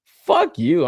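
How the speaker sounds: hammed-up refusal to pay; mock angry retort. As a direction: tell the overpriced seller off. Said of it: "I'm like".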